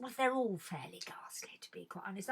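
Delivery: unvoiced confiding aside; whispered confession. Real, not acted. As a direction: lean in and whisper it.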